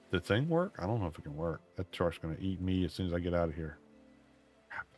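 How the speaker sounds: normal talking voice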